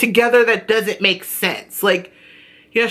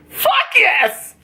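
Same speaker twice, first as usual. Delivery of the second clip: a much higher, whooping cheer; real excitement; 10 semitones higher than usual. Real, not acted. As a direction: burst out in genuine glee. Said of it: "I'm so excited".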